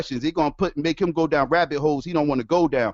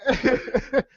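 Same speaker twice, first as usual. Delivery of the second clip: real helpless laughter; 9 semitones higher than usual. Real, not acted. Can't stop laughing at Darwin.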